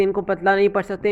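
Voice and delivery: ordinary talk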